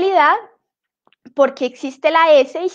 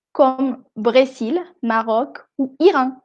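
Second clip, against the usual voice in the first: slow teaching delivery. Shown as a demonstration. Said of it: "teacher models slowly".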